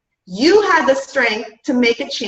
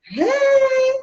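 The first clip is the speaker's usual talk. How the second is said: high, drawn-out creeper hello; a goofy stranger grinning at you in the grocery store; mock creeper saying hi; creepy greeting; 10 semitones higher than usual. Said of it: "that creeper ... just like".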